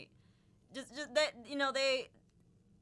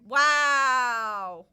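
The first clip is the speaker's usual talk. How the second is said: long, loud drawn-out wow; loud exclamation; 12 dB louder than usual. Real, not acted. Player reacting to a red card, loud drawn-out wow. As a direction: belt it out and stretch it.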